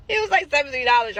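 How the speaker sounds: bursting with amused disbelief; amused exclamation. Real, not acted. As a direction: make the tiny sum sound absurd. Laughing at tiny amount.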